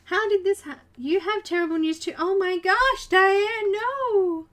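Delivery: high, loud dismayed cry; real shock. Real, not acted. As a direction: cry out at terrible news from a friend. Reacting to terrible news.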